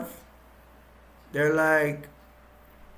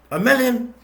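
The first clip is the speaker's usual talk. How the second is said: high, loud mock shock; incredulous outburst; 8 semitones higher, 6 dB louder than usual. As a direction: blurt it back in disbelief, pitched way up. King balks at the price.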